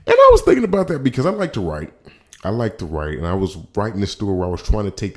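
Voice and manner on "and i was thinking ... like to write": emphatic. Real, not acted. Emphatic opener, then repeated plain.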